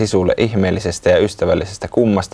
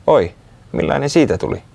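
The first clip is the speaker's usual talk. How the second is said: curious; 7 semitones higher than usual. A narrator's reading. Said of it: "Sisu kysyi uteliaana".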